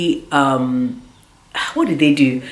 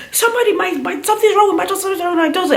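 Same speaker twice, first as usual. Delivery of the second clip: much higher, fast imitation of a frantic mother; panicked mom; 14 semitones higher, much faster than usual. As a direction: mimic mom panicking for help. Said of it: "my mom starts screaming".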